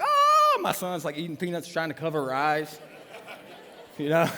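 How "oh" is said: loud high mock shriek; daughter's scream. Daughter seeing dad handcuffed, shrieking. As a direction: imitate a child's horrified scream. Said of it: "my daughter's like".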